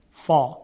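فا with a dark backed vowel; Iraqi heavy fa. Retold or imitated. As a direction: say فا thick, like "fɔ". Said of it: "از لحاظ فصاحت".

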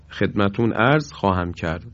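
usual speaking voice

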